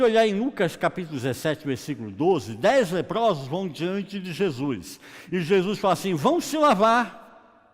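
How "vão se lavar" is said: loud, drawn-out, high command; acted command. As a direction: call out an order to a group, stretched. Jesus's command to lepers, acted. (ordem em voz alta, alongada)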